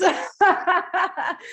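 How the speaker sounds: high emotional laugh; high teary laugh. Real, not acted. Laughing at her own farewell tribute, high and teary. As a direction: laugh it out, overwhelmed.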